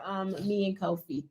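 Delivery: ordinary delivery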